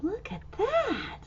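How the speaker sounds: ordinary delivery, nothing marked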